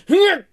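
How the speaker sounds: loud high straining grunt, squeezing out a word. Acted. Friend strains hard to squeeze out an n-word, loud grunt. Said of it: "何気張ってんだ".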